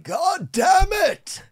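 very high, drawn-out shout; wake-up roar of a grumpy dad; father's yell. Retold or imitated. Guest imitating his woken father, stretched high shout. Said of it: "he'd be like".

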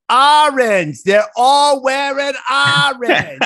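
high, loud mock server shout; imitating the server. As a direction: belt it out high like the excited waitress. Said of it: "Like that".

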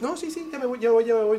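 high, loud flustered repetition; imitating a spooked guest; panicky babble. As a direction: babble your way out the door. Imitating guest fleeing scared.

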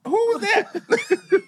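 very high, stretched-out question; comic baby voice. Retold or imitated. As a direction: play an astonished baby, high and slow through laughter. Breastfeeding baby's reaction, acted.

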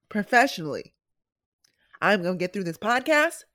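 ordinary talk, nothing marked

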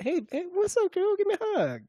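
very high, put-on voice; hammed-up high imitation of a flirt; mock flirting player. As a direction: pitch it way up as a teasing imitation. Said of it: "He's like".

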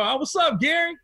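high, casual imitation of a friend; breezy call from the doorway; cheerful greeting. The host's friend greeting him at the door, light cheerful. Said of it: "As soon as he answered the door".

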